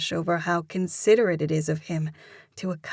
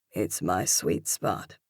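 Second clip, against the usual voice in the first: deep male voice; 11 semitones lower, 3 dB quieter than usual. Acted. Male lead voiced low.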